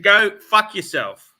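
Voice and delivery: retold angry dismissal. Retelling how he dismissed the car salesman, slow angry emphasis. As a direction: tell him off slowly and forcefully.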